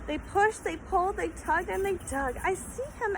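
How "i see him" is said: excited. Acted. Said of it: "Edward shouted".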